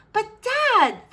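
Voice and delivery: high-pitched daughterly objection; protesting. A narrator's reading. Princess objects to her father's order, protesting.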